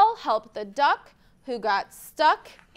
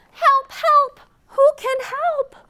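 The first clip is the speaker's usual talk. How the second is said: high, loud little duck crying help; duck calling for help; 11 semitones higher, 7 dB louder than usual. Acted. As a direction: squeak out a panicky call for help.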